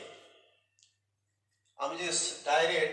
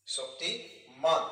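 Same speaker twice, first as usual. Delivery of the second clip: slow model word; much slower than usual. Shown as a demonstration. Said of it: "Shaktimaan RuPay".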